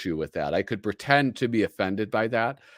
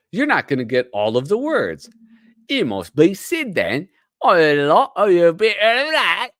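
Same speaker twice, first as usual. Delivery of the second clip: high, exaggerated Cockney woman; hammed-up Cockney falsetto, getting louder; female Cockney accent; 8 semitones higher, 6 dB louder than usual. Shown as a demonstration. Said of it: "That's my female Cockney accent".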